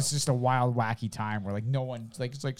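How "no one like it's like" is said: usual speaking voice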